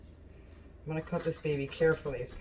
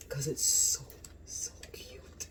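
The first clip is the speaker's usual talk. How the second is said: cooing whisper; 4 semitones lower, 11 dB quieter than usual. Real, not acted. Cooing over little bowl.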